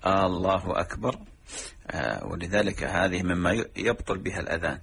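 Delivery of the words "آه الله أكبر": "آه، الله أكبر" voiced as a rising question; questioning adhan error. Shown as a demonstration. Teacher repeating the wrong questioning call to prayer. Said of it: "لأن هذا استفهام إنكاري".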